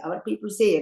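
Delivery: ordinary delivery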